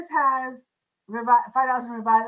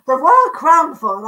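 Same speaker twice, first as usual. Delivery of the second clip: a quick hammed-up English accent, louder and higher; mock English accent; 4 semitones higher, 9 dB louder than usual. Host reading a china brand to live viewers, mock English accent. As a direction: put on a playful English accent for the brand name The Royal Crownford. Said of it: "I'll get my English accent going".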